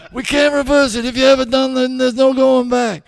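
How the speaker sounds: loud, high punchline voice; doctor in a hammed-up high voice; high-pitched doctor. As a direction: belt it high, slowing at the end. Doctor now has patient's high voice.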